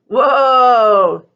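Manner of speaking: a sharp call to halt the dogs; driver's sudden whoa to the dogs; shouted whoa. Acted. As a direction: rein them in with a shout. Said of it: "he shouted".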